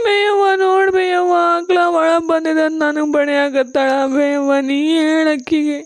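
slow, drawn-out tearful wail; crying complaint. Acted. Younger sister tells mother she is being hit, crying. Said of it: "ಆ ಹುಡುಗಿ ಹಂಗ ಅಳಾಗತ್ತಾಳ".

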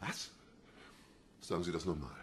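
very quiet, low voice; stunned demand. Acted. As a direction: ask for a repeat almost under your breath. Kommissar kann es kaum glauben. (fassungslos, sehr leise und tief)

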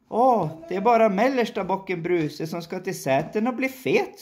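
middle goat voice. Acted. Middle goat answers troll.

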